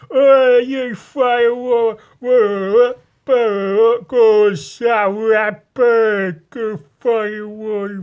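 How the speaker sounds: put-on loud, high, slow drunken football hooligan shouting; mock drunken football hooligan; drunken hooligan yell. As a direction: bellow it like a lager-soaked yob in the street. Mimicking football hooligans outside.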